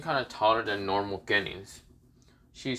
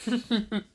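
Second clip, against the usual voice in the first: high, acted villain chuckle; villainous chuckle; 10 semitones higher, 3 dB quieter than usual. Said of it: "Orochimaru laughs and says".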